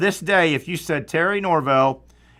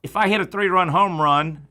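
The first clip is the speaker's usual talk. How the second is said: ordinary talk, nothing marked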